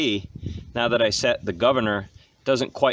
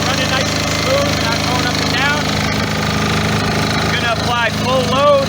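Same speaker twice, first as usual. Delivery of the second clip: raised, high voice over a running motor; shouting over engine; 13 semitones higher, 8 dB louder than usual. Real, not acted. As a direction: project over loud machinery. Narrating beside a running generator.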